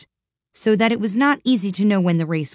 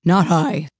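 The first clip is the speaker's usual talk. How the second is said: hasty; 4 dB louder than usual. A narrator's reading. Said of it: "said the Lory hastily".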